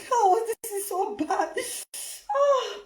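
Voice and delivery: ordinary talk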